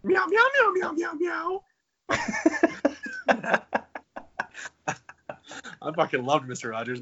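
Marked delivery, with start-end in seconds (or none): 0.0-1.6 high squeaky puppet meows; cat puppet voice. Shown as a demonstration. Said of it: "the scary cat puppet on there?". 2.1-5.8 breathy laugh. Real, not acted. Laughs after cat voice.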